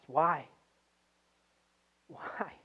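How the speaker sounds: soft why, fading away on the repeat; hushed questioning. Retold or imitated. Preacher voices a grieving family at the bedside, hushed questioning. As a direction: ask it quietly, letting it die away.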